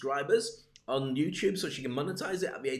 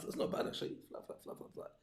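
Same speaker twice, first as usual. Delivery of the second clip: unvoiced whisper of flap flap; 11 dB quieter than usual. Host playing with his watch, whispering. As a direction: breathe the words out with no voice.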